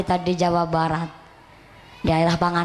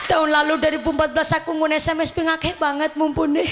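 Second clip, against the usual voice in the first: high, teary mock voice of a gushing fan mother; imitating weeping mother; 8 semitones higher, faster than usual. Teary mother fan gushing to the young preacher, high mock weeping. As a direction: imitate an older lady sobbing out her devotion. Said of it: "Ibu-ibu nangis-nangis begini".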